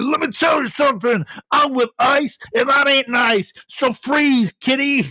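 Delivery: high, loud shouted catchphrase; cheesy wrestler promo. Pretend. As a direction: bark it like a hammy wrestler. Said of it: "And he's like".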